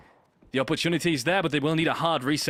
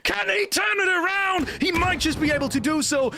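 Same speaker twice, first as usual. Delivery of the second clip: shouting high hype call; esports caster; 11 semitones higher, 3 dB louder than usual. Real, not acted. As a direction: yell the clutch play. Live play-by-play.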